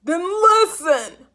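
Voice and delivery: slow, loud furious roar. A narrator's reading. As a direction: roar it out, fed up. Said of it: "roared Mister Popper".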